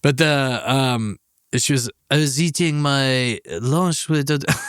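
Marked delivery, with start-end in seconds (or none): 2.1-4.6 slow put-on French accent; amateur French accent, half abandoned. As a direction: imitate a Frenchwoman, drawing the words out. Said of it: "she had a French accent".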